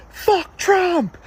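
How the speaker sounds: high-pitched re-enacted scream; retold scream. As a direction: scream it out like at a passing driver. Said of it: "I screamed out".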